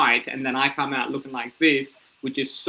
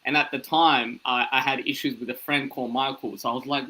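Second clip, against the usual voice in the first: usual speaking voice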